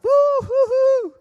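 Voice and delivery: very high, loud, slow hooting whoop; wired woo-hoo in the ship hallway; excited whooping. Retold or imitated. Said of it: "I'm just so excited".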